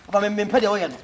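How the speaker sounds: ordinary talk, nothing marked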